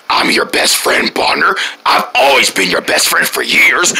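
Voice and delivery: low creepy voice; lightly sinister best friend; scary Tun. Acted. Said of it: "not the scary Tun".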